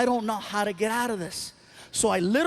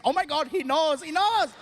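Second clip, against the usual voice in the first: high, excited chant; mock crowd screaming; 6 semitones higher than usual. Crowd thrilled by the local reference, mock excited. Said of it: "They were, like, screaming".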